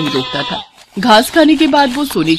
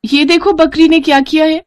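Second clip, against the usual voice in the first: high-pitched, eager wife; excited showing; 7 semitones higher than usual. Acted. Wife shows husband what the goat did, excited. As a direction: show off an astonishing find.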